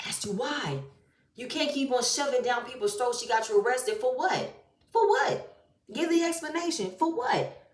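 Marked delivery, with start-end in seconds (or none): 4.9-7.7 exasperated demand. Real, not acted. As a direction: throw the question up high in disbelief. Demanding an explanation, repeated.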